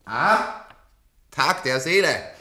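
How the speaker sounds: grand announcement. Acted. Announces soul day grandly.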